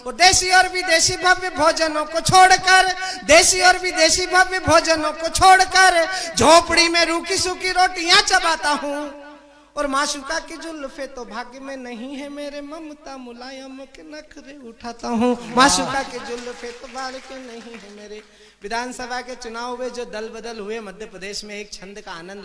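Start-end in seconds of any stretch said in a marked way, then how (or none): 0.0-9.3 shouted, high-pitched verse at full force; declaimed poem. Acted. 9.8-18.2 rhythmic verse with stretched phrasing; poem recitation. Acted. Recites his comic verse.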